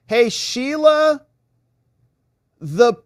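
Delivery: high, slow, sung-out hello; drawn-out greeting. Real, not acted. Streamer greeting a viewer, high and drawn out. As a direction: stretch the name out high and slow. Reading a new chat name.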